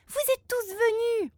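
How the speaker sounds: excited. Acted. Children greet their friends.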